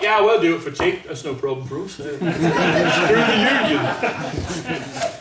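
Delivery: casually mocking British players, laughing through it; mock Brits. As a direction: laugh as you play the cheeky Brits. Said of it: "the Brits are like".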